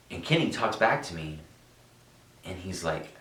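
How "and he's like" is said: ordinary delivery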